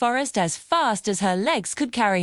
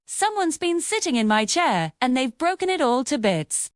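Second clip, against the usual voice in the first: plain reading voice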